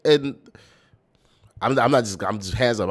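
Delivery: throwing hands up in loud exasperation; exasperated. Real, not acted. Exasperated reaction to the verse.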